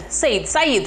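SAID as "sayed", then like the name "Sa-eed"; mistaken like a name. Retold or imitated. Teacher teasing students' mistake, SAID turned into a man's name. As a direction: stretch SAID into the name Said. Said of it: "parcă e un bărbat".

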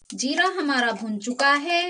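normal talking voice

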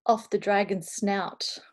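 usual speaking voice

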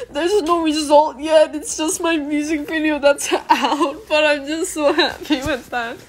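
giggling while teary; laughing through tears. Real, not acted. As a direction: laugh and cry at once, overjoyed. Laughing and crying over video.

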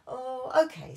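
loud indulgent oh OK; lightly voiced relenting dad; giving-in dad. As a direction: give in to the kids. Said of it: "said Daddy".

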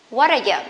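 "What are you" joined as one short unit; reduced "What are you" with a tapped T; linked words. Shown as a demonstration. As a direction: run "What are you" together, T softened to D. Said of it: "we can link them together".

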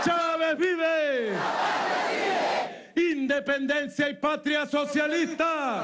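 rousing shouted rally cries; booming balcony speech. Real, not acted. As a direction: whip up the crowd with each cheer. Rally cheers from the podium. (vítores atronadores desde la tarima)

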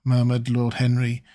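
ordinary delivery, nothing marked

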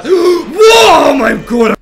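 high, loud gasp and cry; startled scream. Real, not acted. Gamer nearly caught, loud startled scream. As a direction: shriek in fright. Jumpscare in game.

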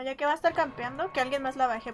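usual speaking voice

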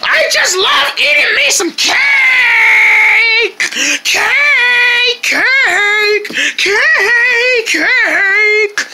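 manic excited voice. Acted. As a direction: squeal like you're buzzing on sugar. Sugar rush cake chant.

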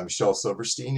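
usual speaking voice

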